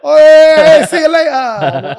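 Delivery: mock getaway. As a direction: yelp it out while sneaking off. Acting out slipping away unseen.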